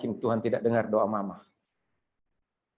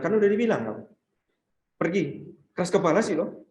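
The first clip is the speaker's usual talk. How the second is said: smug, high dismissal; arrogant; 4 semitones higher than usual. Acted. Disciples smugly dismiss the mother, arrogant. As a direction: look down on her as you send her off. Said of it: "dengan jumawa".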